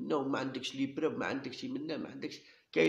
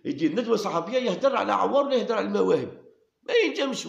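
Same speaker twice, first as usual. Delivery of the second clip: louder and higher, daring him to speak; heated challenge; 7 semitones higher, 6 dB louder than usual. Real, not acted. Ranting at the official who would not dare speak. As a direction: push it up, shouting the dare.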